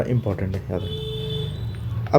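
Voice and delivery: Important है said softly, then याद रखना in a slow whisper; soft, slow whispered reminder to remember; hushed reminder. Shown as a demonstration. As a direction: drop to a slow whisper like sharing a secret tip.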